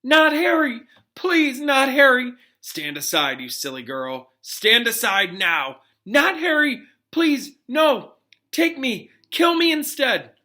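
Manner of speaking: high, loud, desperate begging; pleading scream. Acted. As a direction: beg for the child's life, screaming. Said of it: "A woman".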